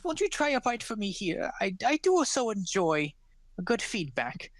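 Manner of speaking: put-on high old voice, coaxing then fretful; a frail baker begging for praise; pleading old woman. Old woman urges the gnome to taste her pie, high coaxing voice.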